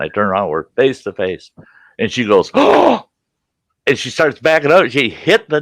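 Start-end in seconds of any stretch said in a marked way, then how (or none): 2.5-3.1 high, loud gasp of shock; startled gasp. Retold or imitated. Building manager coming face to face with resident, imitated startled gasp.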